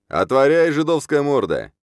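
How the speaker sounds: high, loud demanding shout; rowdy yell. A narrator's reading. Newspapermen pounding on a merchant's gate, yelling. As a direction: bellow it like a drunken mob. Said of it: "газетиры заорали".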